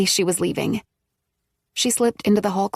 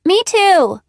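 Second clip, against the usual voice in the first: put-on much higher, louder little girl; child's voice; 11 semitones higher, 7 dB louder than usual. A narrator's reading. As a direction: chime in like a small kid. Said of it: "came Rebecca's".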